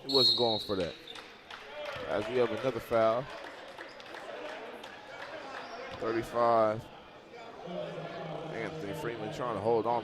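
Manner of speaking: brisk basketball commentary; live play-by-play. Acted. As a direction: call the action as it happens.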